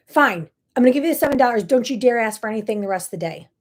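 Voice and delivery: quick, loud, exasperated mother; irritated snap. Retold or imitated. Mother snapping at her begging son, loud and fast. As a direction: bite the words off, fed up and fast. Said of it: "I snapped at my son".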